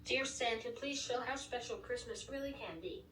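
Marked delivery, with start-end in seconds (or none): none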